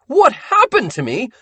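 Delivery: high, loud, manic accusing rant; a mad ink-drunk man shouting blame; unhinged Sammy ranting. Acted. Sammy shouting at Buddy that he was there.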